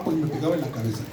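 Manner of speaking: ordinary talk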